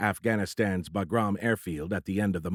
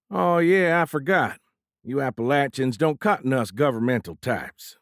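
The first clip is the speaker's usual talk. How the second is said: put-on Texan accent; 5 semitones higher, 5 dB louder than usual. A narrator's reading. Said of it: "Exaggerating his own Texan accent".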